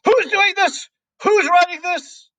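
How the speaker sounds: very high, loud, panicked demand; yelling at nobody. A narrator's reading. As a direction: shriek the questions out at the whole room. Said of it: "she yelled at nobody in particular".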